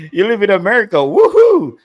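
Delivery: sarcastic cheer, high and loud; mock cheer. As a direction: cheer him on in pure mockery. Mocking the commenter's claim.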